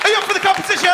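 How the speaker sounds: very high-pitched rousing MC yell; top-of-the-range rallying cry; bigger hype shout. Real, not acted. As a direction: demand a roar from the crowd. MC demands louder crowd response.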